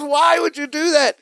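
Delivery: high-pitched mock scolding; mean outburst. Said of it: "nice Cousin Larry becomes mean Cousin Larry".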